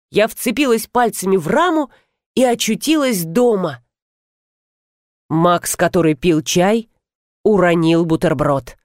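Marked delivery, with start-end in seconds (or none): none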